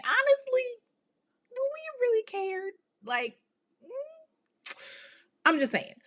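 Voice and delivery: high, mocking don't-care voice; sassy aside. Real, not acted. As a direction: toss it off high and dismissive. Sassy aside about the killer.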